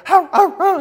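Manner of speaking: loud, high imitated sled dog barks; dog barking. Preacher imitating sled dogs eager to race, loud barks. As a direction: bark like eager sled dogs straining at harness.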